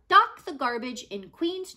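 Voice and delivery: ordinary delivery, nothing marked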